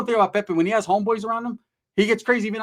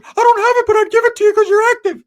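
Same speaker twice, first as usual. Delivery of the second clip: much higher, louder squeaky mock of Ricky; 10 dB louder than usual. Narrator squeaking out cousin Ricky's answer to the borrow request.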